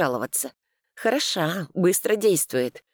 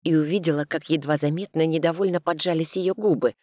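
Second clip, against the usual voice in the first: ordinary delivery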